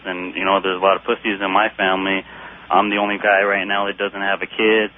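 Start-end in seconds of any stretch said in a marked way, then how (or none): none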